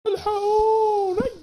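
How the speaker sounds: hammed-up high, slow shout for help; husband howls for rescue at the price; mock cry for help. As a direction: wail for the police as if robbed. Husband shocked at three thousand.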